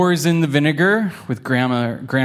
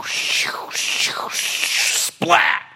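whispered whooshes ending in a high splat; whispered rocket whoosh; much slower than usual. Acted. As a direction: make the rocket fizz then splat. Rocket sound effects.

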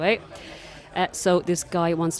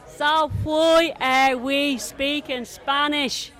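very slow, louder question; 9 semitones higher, 4 dB louder, much slower than usual. Retold or imitated. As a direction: spell it out loudly for a foreigner. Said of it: "speak really loud and really slow".

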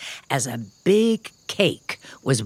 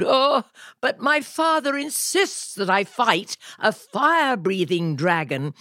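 much higher, whiny squeaky knight; moaning knight; 8 semitones higher than usual. A narrator's reading. Sir George moans to the dragon about his father's demand. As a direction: moan it like a fretful little knight. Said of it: "Sir George moaned".

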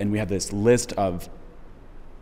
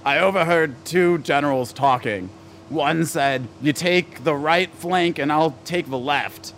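high-pitched comedian impression, punchline drawn out; Phyllis Diller; 7 semitones higher, slower than usual. Host tries out the comic's joke, hammed-up impression. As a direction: deliver the joke in a put-on, higher comic voice. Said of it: "I'm doing this in my Phyllis voice".

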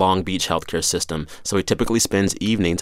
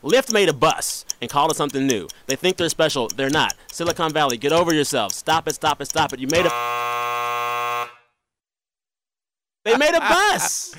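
angry rant; 4 semitones higher than usual. Real, not acted. Timed complaint rant segment.

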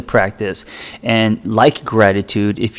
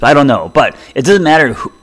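ordinary talk

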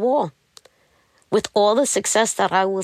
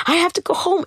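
retold scream; 12 semitones higher than usual. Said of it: "I started screaming in the parking lot".

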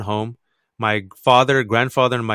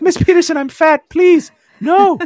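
much higher, louder squeaky plea; pleading child; 8 dB louder, slower than usual. Retold or imitated. Fat shy kid begging the teacher not to make him read, much higher and louder. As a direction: beg like a terrified shy kid. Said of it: "And I'm like".

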